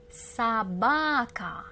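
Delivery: very slow, syllables drawn apart; slow model word. Shown as a demonstration. Teacher modelling the word slowly for learners. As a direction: stretch it out so they hear each part. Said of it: "Try to read it first".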